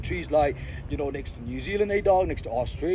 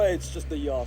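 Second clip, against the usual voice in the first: ordinary talk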